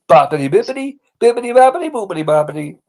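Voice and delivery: mock Italian gibberish. Family Guy Italian gibberish.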